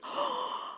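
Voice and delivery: real sharp intake of breath; excited gasp. Real, not acted. Streamer reacts to a fantastic review, excited gasp. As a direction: gasp with delight.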